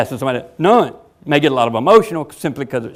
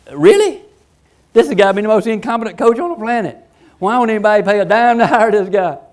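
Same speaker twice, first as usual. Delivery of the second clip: incredulous viewer; 6 semitones higher than usual. Retold or imitated. Fan watching the coach's excuses, incredulous. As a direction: scoff at the screen in disbelief. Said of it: "You'd be looking at the screen like".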